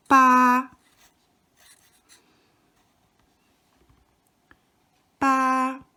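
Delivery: 八 held high and level, first tone. Shown as a demonstration. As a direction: sing 八 high and steady. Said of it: "has accent number one".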